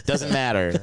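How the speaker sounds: normal talking voice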